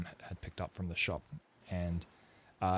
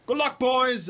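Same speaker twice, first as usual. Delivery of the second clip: calling out; 11 dB louder than usual. Acted. Balin calls to departing sailors.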